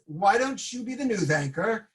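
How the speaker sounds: ordinary delivery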